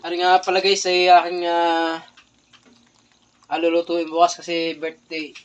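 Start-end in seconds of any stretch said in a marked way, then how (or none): none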